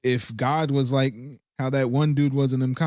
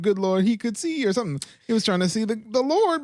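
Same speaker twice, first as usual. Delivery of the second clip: high mock voice; 8 semitones higher, faster than usual. Mocking Zacchaeus climbing.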